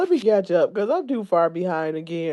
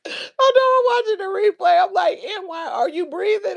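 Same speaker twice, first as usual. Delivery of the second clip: high voice on the edge of cracking up; tearfully amused, pitched way up; fighting laughter; 11 semitones higher than usual. Real, not acted. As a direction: retell it while holding back laughs. Still laughing while retelling replay.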